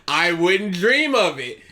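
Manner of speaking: slow, low sarcastic drawl. Shown as a demonstration. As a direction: say it dripping with sarcasm. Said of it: "say it sa-, sarcastically".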